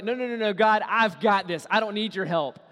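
stubborn believer refusing God's help, loud; proud refusal. Retold or imitated. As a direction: brush God off loudly. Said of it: "you're like".

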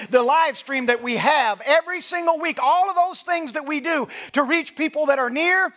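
loud, high, climbing sermon peak; full-force preaching. Acted. Pastor rallying the congregation, preaching at full force. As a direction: preach it out to the back row.

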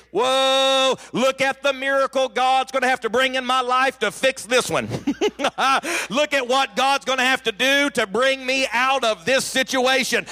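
a high, excited exclaim with a laugh; joyful faith. Pretend. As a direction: marvel like one who expects a miracle. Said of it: "you can choose to say".

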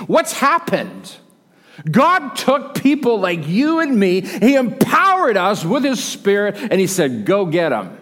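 loud, rising revival preaching; preaching at full force. Acted. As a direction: pastor driving home Pentecost to the church, loud and rising.

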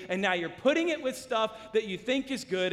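usual speaking voice

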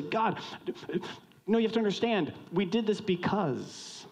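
high-pitched, protesting plea; pleading excuse. Acted. Sinners explain themselves to God, pleading. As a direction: plead your case, pitched high and slowing at the end.

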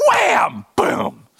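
shouted high wham; crash sound word. Retold or imitated. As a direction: yell it like a rocket slamming down. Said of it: "they tried to land that just went".

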